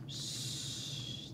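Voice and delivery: unvoiced hush for a sleeping boy; soft shushing whisper; hushed shh. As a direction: hush them so he stays asleep. Hushing children, sleeping boy.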